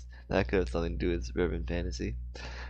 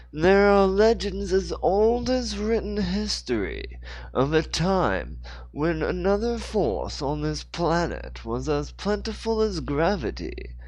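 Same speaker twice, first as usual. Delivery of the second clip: higher, slow, grand British storyteller voice; British voiced Joseph; 9 semitones higher than usual. Joseph Dixon tells Hannah the legend, slow and grand. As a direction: intone the legend slowly. Character line in read story.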